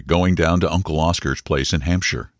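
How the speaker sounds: ordinary delivery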